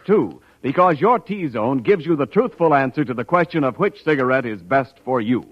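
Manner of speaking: ordinary delivery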